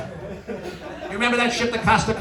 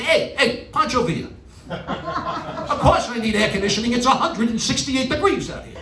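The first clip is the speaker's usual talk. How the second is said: higher, pushy New York yell at the cab driver; mock New Yorker rant; 7 semitones higher than usual. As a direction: bark it like a fed-up New Yorker. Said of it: "be a real New Yorker and say".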